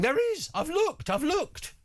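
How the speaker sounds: imitating an overexcited colleague, then deflating; excited producer. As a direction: blurt it like a keen kid who just found it. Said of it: "He's now in my ear going".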